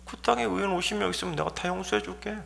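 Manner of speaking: high, soft, quick concession; instant easy assent. Acted. God answering angry Abraham, instant soft agreement. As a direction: agree at once without any fight. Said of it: "하나님께서 그 말을 딱 듣자마자".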